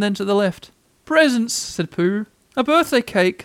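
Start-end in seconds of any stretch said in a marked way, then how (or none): 1.0-1.5 high, baffled bear; high-pitched bewilderment; puzzled surprise. A narrator's reading. Pooh is baffled, looking for presents. 2.6-3.4 high, baffled bear; high-pitched bewilderment; puzzled surprise. A narrator's reading. Pooh is baffled, looking for cake.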